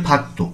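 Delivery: ফাক্তো with a tense t, not d; corrected ফাক্তো, t in the second syllable; t for digut. Shown as a demonstration. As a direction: say ফাক্তো with k running into a hard t. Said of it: "এটি উচ্চারণ হবে".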